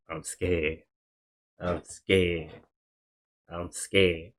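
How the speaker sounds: soft, retold fearful self-talk; scared muttering. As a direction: quietly imitate someone rocking and repeating it to himself. Re-enacts rocking back and forth, scared.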